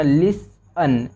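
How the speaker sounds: teacher sounding out listen; slow drawn-out listen. Shown as a demonstration. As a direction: stretch the word listen very slowly, syllable by syllable. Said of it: "और एन को ना पढ़ेंगे ही".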